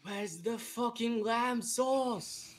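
high, slow mock chef rant; Gordon Ramsay.

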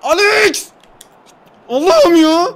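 real shout of despair at a missed goal; anguished shout. Real, not acted. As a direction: scream as the shot hits the post. Shot hits the post.

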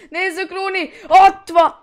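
high, loud excited yell; excited shout. Real, not acted. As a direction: shout as the chance opens up. Goal chance, shouting at game.

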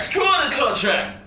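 slow, exasperated outburst; fed up. Acted. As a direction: snap back, fed up. Roommate fed up, argument.

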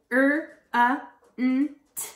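"F-R-A-N-T" as separate speech sounds; "F-R-A-N-T" sound by sound, gaps between; word chopped into sounds. Shown as a demonstration. Teacher segmenting front for kids, "F-R-A-N-T" chopped up. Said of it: "I'm going to chop up a word".

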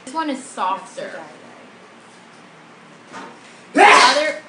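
talking while chewing candy; words slightly muffled by chewing; mouth full, chewing. Real, not acted. Seen 0: Left speaker puts gummy in mouth, jaw working while talking.

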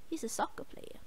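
unvoiced whispered aside. Real, not acted.

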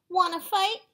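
loud, high, rising dare; punchy storybook taunt; acted challenge. A narrator's reading. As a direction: pick a fight like a cocky storybook bug. Grouchy ladybug challenges stag beetle.

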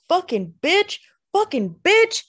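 loud, high repeated screams; furious shriek. A narrator's reading. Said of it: "King shrieked again".

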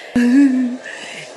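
shrieking burst of laughter; loud delighted laugh. Real, not acted. Laughing at the catfish.

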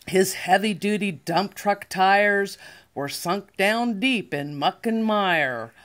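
usual speaking voice

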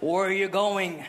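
slow, low officer voice in English; imitated customs officer. Officer questions family at the border, slow low imitated voice. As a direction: question them slowly like a border guard. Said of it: "Je vois le douanier qui s'approche".

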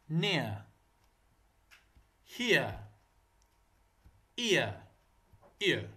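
"near", "here", "ear" with the final R left silent; non-rhotic "near", "here", "ear", each word on its own with a falling tune. Shown as a demonstration. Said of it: "الراء ما يلفظ".

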